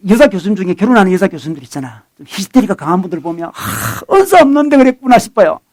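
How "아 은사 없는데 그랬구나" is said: gasped aside, then a higher-pitched realization; mock realization. Preacher voicing his thought about hysterical colleagues, mock realization. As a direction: act out a knowing private thought.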